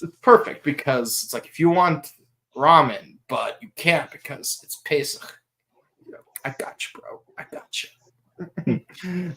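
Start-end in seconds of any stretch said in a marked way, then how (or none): 6.1-9.3 breathy whisper, slowed right down. Pretend. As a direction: whisper it like a secret promise. Whispered reassurance, joking.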